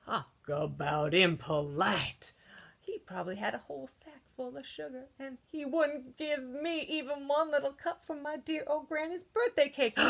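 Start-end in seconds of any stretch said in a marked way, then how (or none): none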